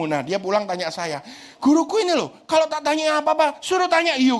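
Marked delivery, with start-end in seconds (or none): 1.6-4.3 lightly voiced complaining kid. Son complaining to dad about the teacher, a higher whiny kid voice. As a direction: mimic his son grumbling about the teacher.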